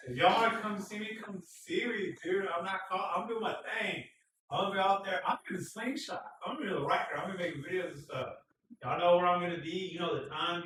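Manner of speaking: high, loud, excited rambling; high-energy hype to viewers; hyped rant. Real, not acted. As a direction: pump up the crowd about Dallas.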